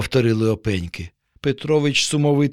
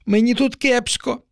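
small high voice, a touch forlorn; a lonely baby crab speaking after a long silence; sad little crab; 10 semitones higher than usual. A narrator's reading. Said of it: "після тривалої мовчанки мовило воно".

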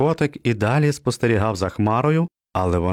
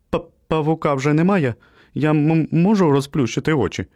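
scared stammer; 6 semitones higher than usual. Acted. Наляканий песик затинається.

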